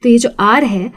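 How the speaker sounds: ordinary delivery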